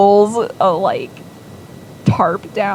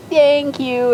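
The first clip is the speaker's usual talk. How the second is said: chirpy daughter; 8 semitones higher, 5 dB louder than usual. Retold or imitated. Daughter chirping thanks to dad.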